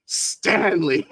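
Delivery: high laughter running into the name; cracking up. Real, not acted. Delighted at iconic Stanley, laughing. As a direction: laugh hard around the word.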